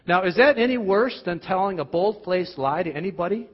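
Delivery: normal talking voice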